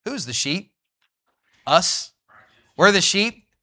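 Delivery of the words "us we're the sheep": ordinary talk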